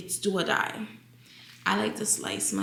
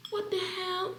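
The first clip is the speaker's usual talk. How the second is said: startled; 12 semitones higher, 5 dB quieter than usual. Real, not acted. Avocado collapses while cutting.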